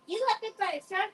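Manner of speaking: high, fast, astonished question; playing everyone hearing about the shark bite, high and amazed; mock amazed. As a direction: gasp it out in disbelief. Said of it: "everyone would be like".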